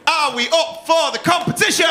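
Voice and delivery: high, rousing MC call; hype shout. Real, not acted. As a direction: whip the crowd up before the show. MC whipping up the crowd.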